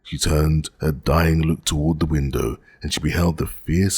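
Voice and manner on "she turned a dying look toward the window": ordinary delivery, nothing marked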